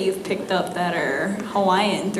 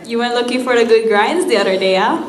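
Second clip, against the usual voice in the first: quick, loud pidgin line with the rising yeah at the end; Hawaiian pidgin; 6 dB louder, faster than usual. Shown as a demonstration. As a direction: rattle it off like a Hawaii local. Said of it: "let's try it one more time".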